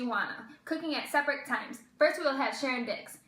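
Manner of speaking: ordinary talk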